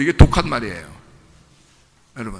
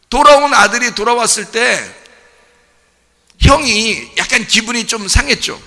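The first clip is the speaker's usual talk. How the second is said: louder, driving sermon voice; fervent preaching; 5 semitones higher, 6 dB louder than usual. Acted. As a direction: preach it out with full force to the congregation. Preaching the father's love. (힘있게 설교하는 목소리)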